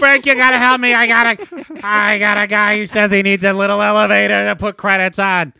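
high pleading showman; high goofy voice. Acted. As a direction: pitch way up, eager and pleading. Plays Hank pleading with Frank.

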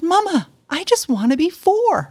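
put-on high, slow pouting little girl; bossy little princess. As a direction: whine it like a stubborn four-year-old. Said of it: "and she said".

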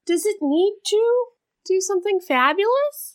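high, sing-song teasing; mocking high voice. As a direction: tease her with a mock fancy lilt. Teasing friend's fashion demand.

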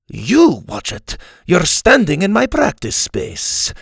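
slow, loud indignant Spanish swordsman; angry Spanish accent. A narrator's reading. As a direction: snap back at her. Inigo quarrels back.

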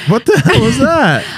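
high squealing what the hell; shocked high squeal. Real, not acted. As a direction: shriek it in disbelief. Reacting to flubbed intro.

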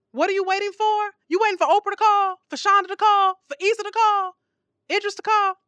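high, loud rhetorical barrage; preaching at full force; rising preacher challenge. Real, not acted. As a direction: fire the questions at the congregation.

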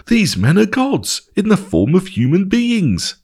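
high-pitched amazement, no real shout; mildly acted cry of wonder, pitched high; amazed shouting. A narrator's reading. Crowd amazed at a healing, mock amazed shout. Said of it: "completely amazed and started shouting out".

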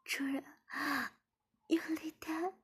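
high, breathy moaning plea. A narrator's reading. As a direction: moan the words out, unvoiced and high. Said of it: "志敏马上呻吟道". (高声喘息着呻吟)

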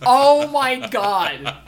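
slow, loud laughing oh my god. Real, not acted. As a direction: laugh it out long. Laughing at the movie ending.

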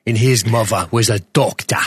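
lightly put-on London accent; mock London accent. Podcaster claims his co-host as brother, in mock London accent. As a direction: play at a Cockney lad. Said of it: "my Jason Statham sort of London thing".

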